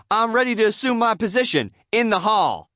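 high, loud, self-important announcement; eager declaration. A narrator's reading. Hall monitor cutting off teacher, declaring loudly. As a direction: declare readiness like an eager recruit.

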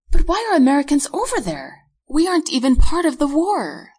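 high, loud indignant objection; protesting. A narrator's reading. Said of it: "I protest".

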